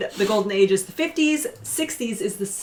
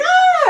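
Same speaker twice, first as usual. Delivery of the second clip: mock falling scream; 8 dB louder than usual. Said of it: "they were both like".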